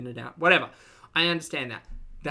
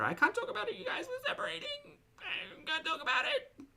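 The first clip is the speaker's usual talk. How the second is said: high, whiny mock sob voice; mock crying; 5 dB quieter than usual. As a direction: whimper it like a fake crybaby. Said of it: "you boohoo crying".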